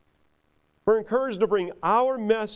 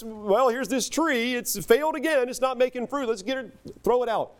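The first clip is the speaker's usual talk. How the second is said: higher, mock impatient 'throw it out'; impatient dismissal; 7 semitones higher than usual. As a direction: play the fed-up owner ready to toss the tree.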